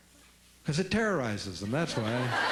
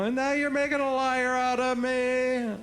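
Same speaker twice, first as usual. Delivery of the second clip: put-on whiny kid; 9 semitones higher, 4 dB louder than usual. Kid whining at parent.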